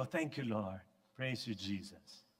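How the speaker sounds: softly murmured prayer of thanks. Retold or imitated. Preacher imitating his quiet thanks after a yawn, murmured.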